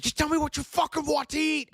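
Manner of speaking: high, loud exaggerated shout; mock furious yell. Boyfriend losing it over dinner choice, hammed-up yell. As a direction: scream it in a hammed-up rage.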